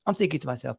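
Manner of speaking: normal talking voice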